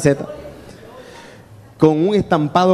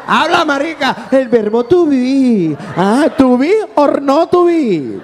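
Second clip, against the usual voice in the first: high, drawn-out jeering brag; mocking boast; 9 semitones higher, slower than usual. Friend mocking the man who bragged of speaking English, high drawn-out jeer. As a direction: sing out the bragging, stretched and high.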